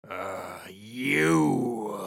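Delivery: low voice of a chained pirate; captive pirate. Acted. Captive pirate eyeing his interrogator, low put-on voice. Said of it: "he just looks and he goes".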